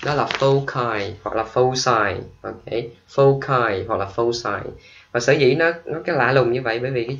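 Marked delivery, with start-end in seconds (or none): 0.4-2.2 foci first with a K sound, then with an S sound; two pronunciations. Shown as a demonstration. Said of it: "có hai cách đọc". 3.1-4.6 foci first with a K sound, then with an S sound; two pronunciations. Shown as a demonstration. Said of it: "có hai cách đọc".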